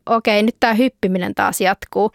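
usual speaking voice

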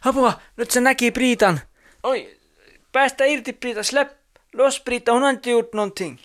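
put-on high, pleading cry for the child; mock alarm. Janitor grabs Priita, pleading. (teeskennelty hätä, korkea ääni)

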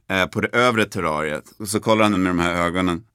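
normal talking voice

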